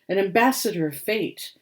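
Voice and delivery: ordinary delivery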